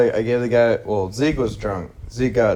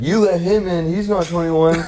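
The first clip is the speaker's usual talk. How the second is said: tattling friend; 8 semitones higher than usual. Retold or imitated. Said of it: "pointed at me and said".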